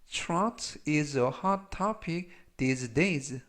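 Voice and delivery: slow, carefully spaced English model sentence; language-lesson model reading. Shown as a demonstration. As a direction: read it slowly for learners to repeat. Model sentences read for learners.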